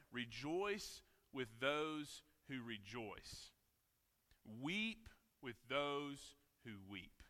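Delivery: unhurried reverent reading; slow scripture reading. Acted. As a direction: let each phrase land slowly. Reads scripture slowly.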